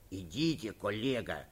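low, soft aside; hushed confidential murmur; whispering. A narrator's reading. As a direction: urge a colleague under the breath. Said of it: "шепнул министр внутренних дел".

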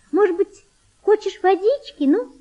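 high, gentle coaxing; thin, tender girl's voice. A narrator's reading. Girl offers a sick boy water, thin tender voice. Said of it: "тонким, нежным голосом".

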